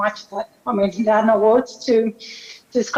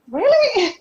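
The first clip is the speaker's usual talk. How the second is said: incredulous; 12 semitones higher, 4 dB louder than usual. Retold or imitated. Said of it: "I was like".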